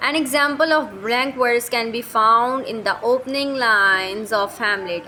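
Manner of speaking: slow, deliberate dictation of English notes; reading notes aloud. Shown as a demonstration. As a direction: read each word out slowly for the class. Reads English notes slowly.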